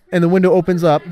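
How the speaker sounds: ordinary delivery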